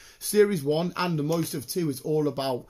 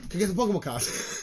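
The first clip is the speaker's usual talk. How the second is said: put-on pleading kid; 4 semitones higher, faster than usual. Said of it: "I was like".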